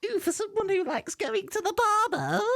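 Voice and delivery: high-pitched mock voice; silly high mimicry of the co-host; mocking echo. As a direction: tease by parroting in a squeaky voice.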